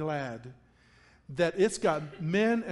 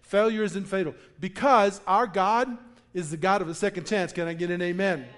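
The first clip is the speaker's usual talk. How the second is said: ordinary talk, nothing marked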